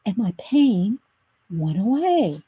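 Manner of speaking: drawn-out comedian's delivery. Retold or imitated. Comedian reliving his relief from toothache, drawn out. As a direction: mimic the comic pausing before the payoff. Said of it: "that's how he said it too".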